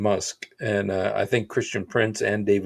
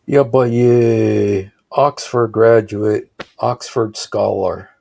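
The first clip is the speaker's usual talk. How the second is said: loud, slow, mocking emphasis; drawn out, scoffing at the title; sarcastic; 8 dB louder, slower than usual. Real, not acted. Mocking the writer's credentials.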